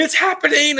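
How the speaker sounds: high, loud excited yell; a high, loud frantic meme yell. Retold or imitated. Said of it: "they're, like, yelling".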